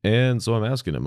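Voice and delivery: usual speaking voice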